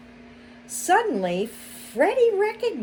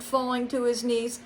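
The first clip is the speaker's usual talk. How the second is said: ordinary delivery, nothing marked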